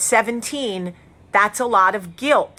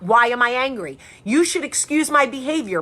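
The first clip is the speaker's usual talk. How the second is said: ordinary talk, nothing marked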